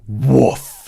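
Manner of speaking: big loud woof. Acted. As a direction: bark out "Woof!" with full lungs. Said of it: "They went, take a deep breath".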